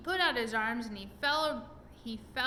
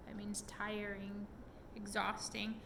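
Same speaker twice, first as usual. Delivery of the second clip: whisper; 7 dB quieter than usual. Real, not acted. Explains word to child aside.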